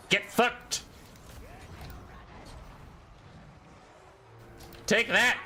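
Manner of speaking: high, gleeful jeer; gloating taunt. Real, not acted. Gamer taunts the enemy unit, high and gleeful. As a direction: rub it in their face.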